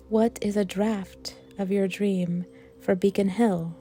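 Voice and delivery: ordinary delivery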